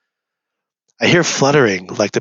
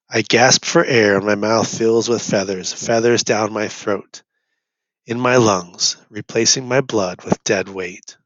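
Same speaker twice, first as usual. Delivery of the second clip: ordinary delivery, nothing marked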